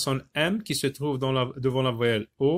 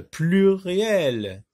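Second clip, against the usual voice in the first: pluriel stretched slowly as one word; long, connected pluriel; drawn-out example word; 4 semitones higher, 4 dB louder than usual. Shown as a demonstration. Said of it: "Plusieurs, par exemple".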